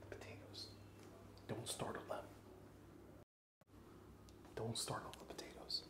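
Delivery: playful whisper to the potatoes. Pretend. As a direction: breathe it out without voice. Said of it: "you gotta be quiet".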